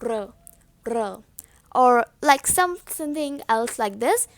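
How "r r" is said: "R" as a low, soft mistaken sound; low, quiet counterexample of "R"; wrong R demo. Shown as a demonstration. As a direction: softly show how not to make the "R". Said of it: "pronounced not like".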